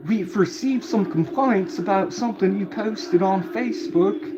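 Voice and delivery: high put-on cop voice; police officers. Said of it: "then they were like".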